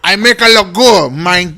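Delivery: hammed-up French accent, loud. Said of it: "what kind of accent was that".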